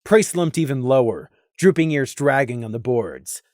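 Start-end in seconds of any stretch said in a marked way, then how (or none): none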